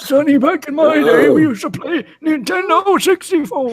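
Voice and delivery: put-on high, loud cranky old man voice; grumpy old man. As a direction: croak it like a crotchety grandpa. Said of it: "how do you do that old man voice so well".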